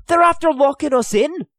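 high, urgent alarm. A narrator's reading. Hugh telling the others they are locked in, alarmed. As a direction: blurt out the bad news.

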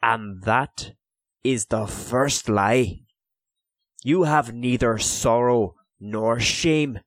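slow and deliberate, each word placed; measured, careful accusation; clear and careful. Acted. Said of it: "said clearly and carefully".

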